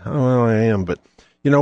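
normal talking voice

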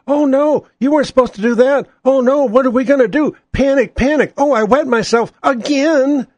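high, whiny mock-panicking Democrats; squealing, hammed-up panic over the surprise vote. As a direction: ham up a flustered coward caught out.

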